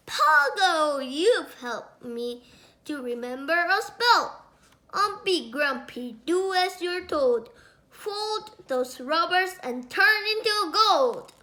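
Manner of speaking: loud, gleeful incantation; spell casting. Acted. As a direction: chant the spell triumphantly.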